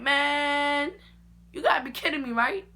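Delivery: high, loud you-gotta-be-kidding-me; disbelief. Retold or imitated. Hair broken off, high loud disbelief. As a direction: cry out in disbelief.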